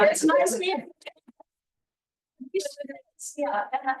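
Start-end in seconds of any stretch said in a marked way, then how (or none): 0.1-2.6 starstruck greeting. Retold or imitated. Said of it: "So I go back to the".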